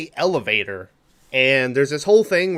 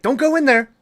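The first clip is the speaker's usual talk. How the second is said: high, louder mock warning; imitated warning lady; 12 semitones higher, 5 dB louder than usual. Retelling what a lady kept saying all night, high mock warning. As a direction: lightly imitate a woman calling out a warning. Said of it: "the whole night was like".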